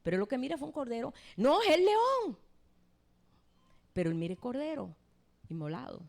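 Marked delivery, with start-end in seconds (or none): 1.4-2.4 high, loud, slow cry of "león"; insistent shout. Acted. Elder correcting Juan about the lion, shouted high and loud. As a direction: shout it out, stretching the word.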